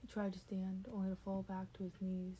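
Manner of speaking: ordinary delivery, nothing marked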